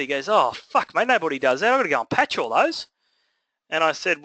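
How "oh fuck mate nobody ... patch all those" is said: higher-pitched retold exasperation; mock complaint, pitch lifted; grumbling plasterer. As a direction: imitate a tradie put out by extra work. Retold plasterer grumbling at extra work.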